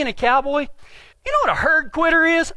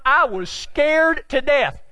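usual speaking voice

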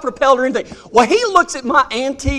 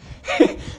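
breathy laugh; 5 dB quieter than usual. Real, not acted. Teller laughs at own story.

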